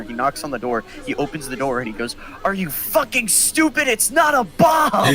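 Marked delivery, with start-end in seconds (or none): 2.4-5.1 high, building mock-furious shout; angry shout. Coach barging in on security, angry shout. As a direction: imitate the coach blowing up at them.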